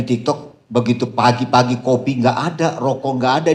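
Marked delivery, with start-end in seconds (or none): none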